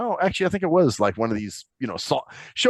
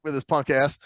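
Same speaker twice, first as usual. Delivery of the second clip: ordinary delivery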